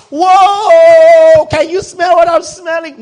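very loud, high, drawn-out holler; mock outcry. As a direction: bellow it in exaggerated disbelief. Mock outburst at a foul smell.

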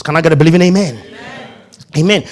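ordinary talk

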